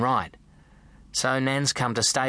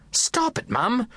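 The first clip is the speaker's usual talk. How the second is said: much higher, pleading mum; a mother begging her own mother to stop; lightly voiced mum cutting in; mum's protest; 11 semitones higher, slower than usual. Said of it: "my mum says".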